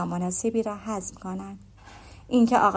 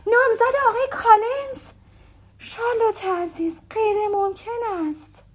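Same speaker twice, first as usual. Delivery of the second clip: much higher, loud burst of disbelief, then slower; astonished outcry; 12 semitones higher, 4 dB louder than usual. Acted. Elizabeth hearing of Charlotte's engagement, shocked and loud. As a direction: blurt it out in shock, pitch flying up. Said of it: "بی اختیار با صدای بلند گفت".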